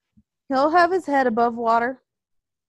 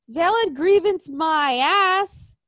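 ordinary talk

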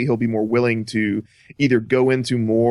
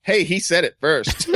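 ordinary delivery

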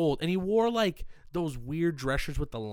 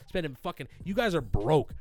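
normal talking voice

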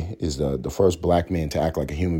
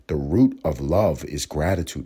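usual speaking voice

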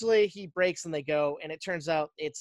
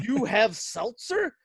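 higher, slow, amazed question; lit-up amazement; 4 semitones higher, slower than usual. Retold or imitated. Great-grandfather offered a seltzer by his great-grandson, amazed. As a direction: draw it out in mock wonder. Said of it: "the great-grandfather lights up".

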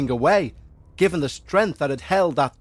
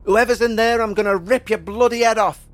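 higher, louder raging threat; furious shout; 6 semitones higher, 4 dB louder than usual. A narrator's reading. Father kicking door, threatening whoever's inside, furious shout. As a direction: roar it while battering the door. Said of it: "he shouted".

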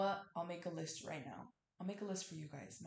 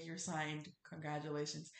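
ordinary talk

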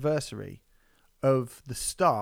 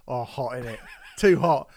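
giggling through a mock moan; laughing mock complaint; 7 dB louder than usual. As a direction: laugh while mimicking her. Mimicking woman, laughing.

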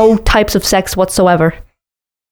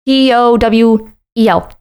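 slow letter-by-letter spelling; each letter of T O W E L said slowly apart; spelled out slowly; 2 semitones higher, slower than usual. Shown as a demonstration. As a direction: spell T O W E L out at half speed. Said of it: "it's two syllables".

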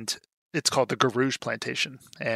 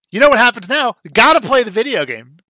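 much higher and louder, booming like a trailer voice; mock dramatic hype; 10 semitones higher, 8 dB louder, faster than usual. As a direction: sell it like a horror movie trailer. Host hyping the movie's game twist.

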